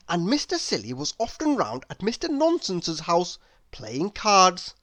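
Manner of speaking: plain reading voice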